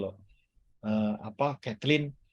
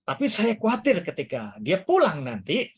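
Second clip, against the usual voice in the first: ordinary delivery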